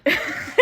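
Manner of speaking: brief breathy chuckle to self; short laugh. Real, not acted. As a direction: let out a quick laugh. Laughs at own remark.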